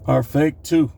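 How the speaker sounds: usual speaking voice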